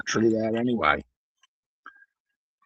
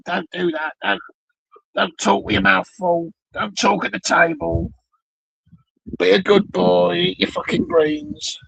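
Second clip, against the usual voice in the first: high-pitched nagging parent voice; scolding parent; 7 semitones higher than usual. Acted. Parent tells child off about table manners, high nagging voice. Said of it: "They say".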